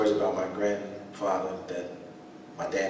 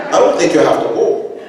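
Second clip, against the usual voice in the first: laughing grandfather; 9 semitones higher, 15 dB louder than usual. Retold or imitated. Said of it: "father voiced in story".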